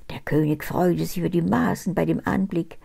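ordinary delivery

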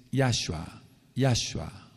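Yahshua as YAH-shua, stressed on YAH; model pronunciation. Shown as a demonstration. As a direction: slowly show YAH-shua, first syllable strong. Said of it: "la pronunciación".

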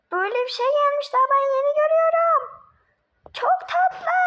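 high, loud, fast amazed squeal; excited squeal. Acted. Child amazed at the candy, squealing high and loud. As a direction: gush over the new candy in a high squeal.

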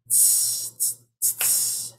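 soft unvoiced tss tss tss like a feathered cymbal; cymbal hiss. Shown as a demonstration. Poet imitating a drummer's cymbal for the crowd, soft hiss. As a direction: whisper the hi-hat rhythm. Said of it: "Feather the rim of the double cymbal".

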